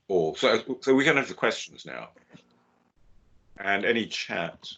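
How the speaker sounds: usual speaking voice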